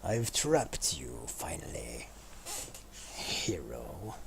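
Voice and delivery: slow, low, whispered villain taunt. Acted. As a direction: whisper the gloat like a dark lord. Gloating at trapped player.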